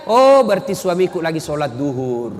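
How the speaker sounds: a wife reassuring herself about her husband; calm trusting wife. Acted. Istri berprasangka baik. (istri yang tenang berprasangka baik)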